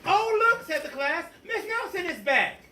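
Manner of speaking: a class that is thrilled, high and loud; excited class. Acted. Class voiced greeting teacher.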